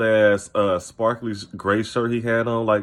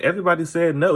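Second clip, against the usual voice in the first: high-pitched mimicry of a clueless investigator; mocking, higher-pitched retelling; mock naive; 7 semitones higher, faster than usual. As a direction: say it high, naively taking it at face value.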